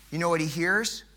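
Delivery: ordinary talk, nothing marked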